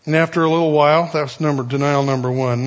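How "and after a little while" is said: ordinary talk, nothing marked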